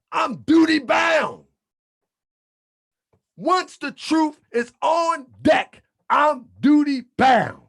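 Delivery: fiery preaching. Acted. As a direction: hammer each point home to the flock. Preaching at full force.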